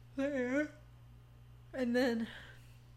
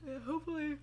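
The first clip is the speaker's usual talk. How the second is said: words stretched through a yawn; 6 semitones higher, faster than usual. Real, not acted. Fiancée yawns while chatting about the puppy's sleep, mid-yawn. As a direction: yawn right through the word hopefully. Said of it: "sorry for yawning".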